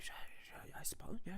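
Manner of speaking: unvoiced, breathy half-hearted assent; mumbled agreement. Nobles shrug off the noble, muttered.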